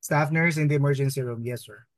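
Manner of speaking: ordinary talk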